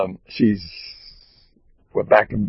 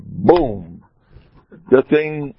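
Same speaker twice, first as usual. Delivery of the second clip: ordinary delivery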